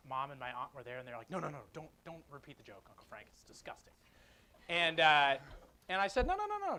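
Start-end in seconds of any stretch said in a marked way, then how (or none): 1.3-3.9 retold warning, sinking almost to a whisper; hushed pleading. Voices relatives begging him to stop. 6.3-6.8 high-pitched retold insistence; rapid string of high noes, begging playfully; eager protest. Acts himself eagerly insisting on joke.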